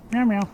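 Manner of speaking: high-pitched meows to lure a cat; imitated cat meow. Real, not acted. Calling a cat over.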